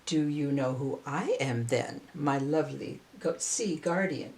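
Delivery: a low, soft counter; poet countering. Acted. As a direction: turn the question back, low and gentle. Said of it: "he countered".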